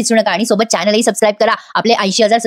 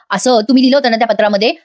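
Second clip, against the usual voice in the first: usual speaking voice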